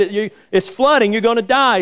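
high-pitched acted warning; mock-alarmed call; retold urgent yell. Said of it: "looks in, yells at him".

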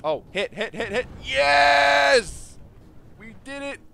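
loud, high victory yell; triumphant shout. Real, not acted. Gamer cheering the winning ballista shot, loud. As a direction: roar it as the target falls.

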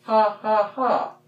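high, staged ha ha ha; fake laugh. Shown as a demonstration. Teacher showing kids the funny-bone laugh. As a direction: act out a big laugh. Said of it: "and then laugh".

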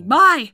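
MY shouted high and very loud; stress on MY. Acted. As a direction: punch MY as if in capitals. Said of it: "the word my in capital letters".

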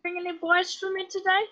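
high-pitched character voice; comic falsetto-like Herbert impression. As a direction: put on a high, creepy Herbert voice. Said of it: "with a very high-pitched voice".

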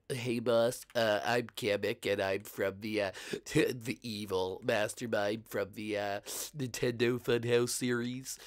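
very nasal, from back of the voice; slow, stuffed-nose villain voice; Kamek. Shown as a demonstration. Kamek introduces himself to the boss, nasally. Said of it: "That's very nasally".

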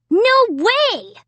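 high-pitched, emphatic denial; protesting. A narrator's reading. Little brother denying the paint mess, protesting. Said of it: "Jed protested".

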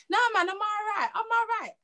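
mock dismissive dad, pitched high. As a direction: brush her off, lightly voiced dad. Dad's denial voiced.

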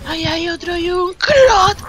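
high, drawn-out cry of alarm; startled gamer yelp, slow and high; startled exclamation. Real, not acted. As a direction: stretch it out high as you spot an enemy. Spots another enemy mid-game.

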